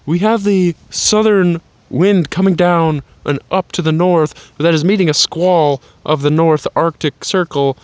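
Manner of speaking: hammed-up on-air forecast, words punched out; mock weather reporter. Man outdoors reporting on the southern wind, mock weather reporter.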